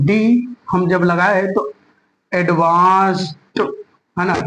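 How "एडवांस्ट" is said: slow, stretched एडवांस्ड ending in T; correct pronunciation. Shown as a demonstration. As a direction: draw out एडवांस्ड, then release a T. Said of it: "टा ही बोलेंगे". (धीरे-धीरे एडवांस्ड, अंत में 'ट')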